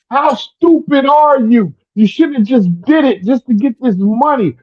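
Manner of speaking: high, exasperated dad imitation; high-voiced mock scolding from a father. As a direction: mimic Dad's telling-off, pitched high. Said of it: "He said".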